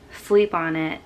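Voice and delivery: usual speaking voice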